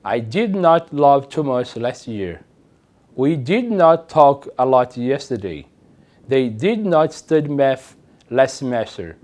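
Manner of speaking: slow, careful English; slow model sentences. Shown as a demonstration. Teacher reads negative examples slowly to class. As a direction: read them slowly for learners. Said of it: "Por exemplo".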